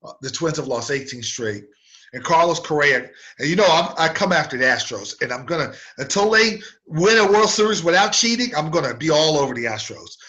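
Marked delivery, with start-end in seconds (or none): none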